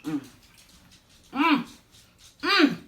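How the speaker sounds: rising pleased mm of taste; savoring mm. Real, not acted. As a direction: hum with delight at the bite. Tasting hot food.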